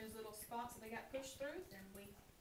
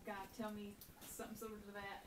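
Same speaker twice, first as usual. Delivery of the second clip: ordinary talk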